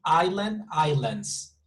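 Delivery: "island" rising, then "islands" falling with a final S; singular-plural demo. Shown as a demonstration. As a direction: contrast "island" with "islands", voicing the added S. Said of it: "it would be like this".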